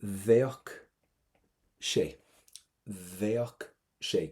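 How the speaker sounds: Bheadh sé word by word, "VYOK... shay"; V start, strong CK. Shown as a demonstration. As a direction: model Bheadh sé with a V and a hard K closing Bheadh. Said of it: "Give me a V at the start and a strong C K at the end".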